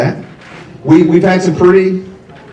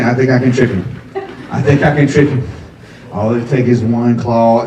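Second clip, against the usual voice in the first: lower, sly plotting cat; a lightly voiced sly cat plotting; scheming cat voice; 6 semitones lower than usual. The cat thinking about its owner, low and sly.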